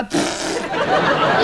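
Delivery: long raspberry; imitated fart noise. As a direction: blow a drawn-out mock fart sound. Making fart noise as counsellor sits.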